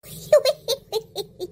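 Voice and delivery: short bright laugh; laughing at her friend's story. Real, not acted. Laughing at friend's onion troubles.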